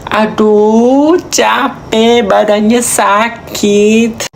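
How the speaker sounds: high, drawn-out complaint; achy and tired. Acted. Tired person complaining of body aches, put-on whine. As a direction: moan about your aching body. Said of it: "Kalau badan kamu capek".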